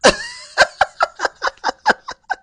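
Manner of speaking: high, loud, rapid cackle; real burst of loud laughter. Real, not acted. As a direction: crack up in a long high laugh. Laughing at Gaetz walking off.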